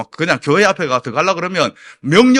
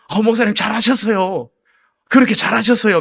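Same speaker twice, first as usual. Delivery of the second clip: higher, emphatic imitated approval; reassuring praise; 5 semitones higher, 5 dB louder than usual. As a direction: back the pastor up heartily. 교인들이 목사를 칭찬하는 재연. (힘주어 칭찬하듯)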